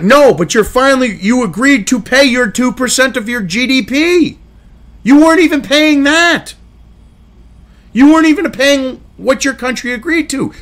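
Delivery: loud, exasperated telling-off; indignant rant. Real, not acted. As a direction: scold them, voice climbing in exasperation. Indignant rant addressed to Macron.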